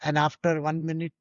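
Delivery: usual speaking voice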